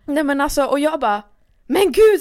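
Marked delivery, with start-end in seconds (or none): 1.7-2.2 startled shout, high and loud; sudden loud, high-pitched outburst; shocked cry. Retold or imitated. Woman retelling being walked in on topless, shocked cry. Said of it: "så här ropar".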